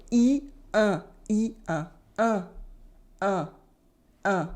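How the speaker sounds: oral "E", then the same vowel nasalised for "un"; oral versus nasal vowel. Shown as a demonstration. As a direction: alternate plain "E" with nasal "un", silent N. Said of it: "but nasal".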